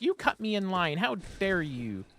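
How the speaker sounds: mock affronted shriek, slowing on how dare you; indignant Karen. Karen accusing another customer in line, mock outraged.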